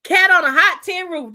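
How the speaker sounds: loud, high burst of remembering; excited recall. Real, not acted. Remembering the movie title for the chat, excited and loud. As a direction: blurt out the title you finally found.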